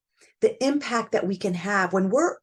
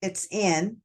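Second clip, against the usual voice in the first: normal talking voice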